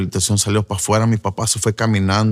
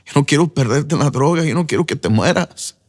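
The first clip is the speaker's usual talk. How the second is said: retold plea to his father, higher and close to tears; a son begging through tears, pitched up; tearful plea; 5 semitones higher than usual. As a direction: son begs his father not to die to drugs, choked up. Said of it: "Yo lo miraba con lágrimas también".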